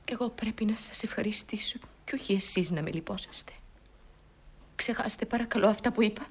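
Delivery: low, very soft, halting plea; hushed sorrow. Acted. Mourning woman begs the man to stop pitying her, hushed. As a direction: wave off the pity almost in a whisper. Acted grief, woman declines the sympathy.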